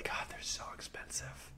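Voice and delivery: whispered under the breath; whispered complaint. Real, not acted. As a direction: breathe it out as a rueful aside. Complaining about wheel prices.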